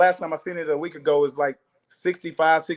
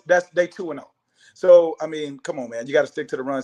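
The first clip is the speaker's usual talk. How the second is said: normal talking voice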